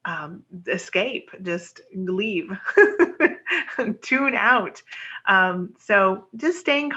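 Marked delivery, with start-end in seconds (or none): 2.5-4.0 light, higher-pitched laugh; short laugh. Real, not acted. Astrologer laughing at the urge to just leave, amused chuckle. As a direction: let out a brief real chuckle. Laughs after joking about escaping.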